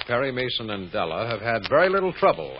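ordinary talk, nothing marked

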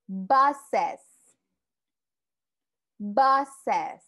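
Buses with a clear es ending; model pronunciation. Shown as a demonstration. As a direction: say Buses crisply for learners. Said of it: "Como pueden ver y pueden escuchar". (pronuncia Buses marcando la terminación es)

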